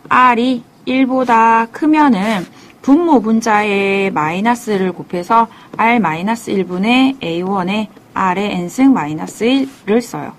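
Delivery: usual speaking voice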